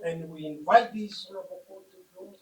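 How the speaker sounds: usual speaking voice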